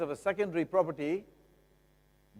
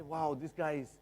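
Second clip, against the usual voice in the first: softer, hushed wow of a buyer in awe; mock starry-eyed gushing; a buyer gasping over the kitchen; dazzled buyer; 9 dB quieter than usual.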